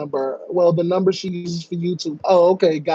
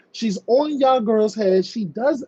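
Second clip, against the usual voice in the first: ordinary talk, nothing marked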